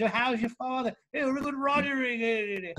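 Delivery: high, laughing fake British accent; imitating a silly Englishman; mock posh English. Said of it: "all those silly expressions".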